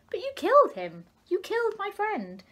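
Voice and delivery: high, loud storybook protest; upset accusation. A narrator's reading. Little fish accuses elders over his friend, high and upset.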